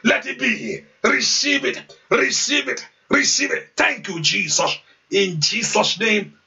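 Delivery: preaching at full force, chanting and calling out; fervent prayer. Acted. Pastor urging his congregation to pray aloud, preaching at full force.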